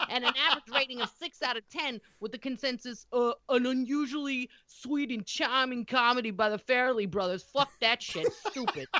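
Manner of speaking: put-on light Boston accent, swearing as she reads. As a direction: reads the stats as a cranky Boston local. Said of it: "in the voice of a heavy Boston accent".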